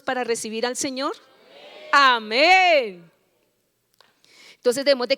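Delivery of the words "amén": loud, drawn-out Amen; rallying amen. Real, not acted. Preacher prompting the congregation to answer, rallying. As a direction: call out a resounding amen.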